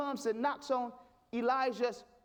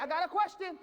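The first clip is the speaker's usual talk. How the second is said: pleading like a pupil wanting to be picked; higher, insistent interruption; eager hand-raiser; 4 semitones higher, faster than usual. Acted. God raising his hand to interrupt, eager hand-raiser. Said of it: "he'll raise his hand".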